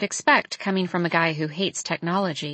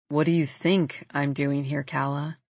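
low sighing male voice; 6 semitones lower than usual. A narrator's reading. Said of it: "He sighs".

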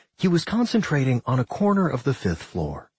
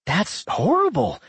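exclaiming; 8 semitones higher than usual. A narrator's reading. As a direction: blurt it out in shock. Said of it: "Doc exclaimed".

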